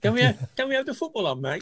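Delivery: put-on Welsh accent. Friend on the podcast mimics a Welsh fan in a Welsh accent. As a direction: ask for the football in a sing-song Welsh accent. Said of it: "Oh, I love the Welsh accents".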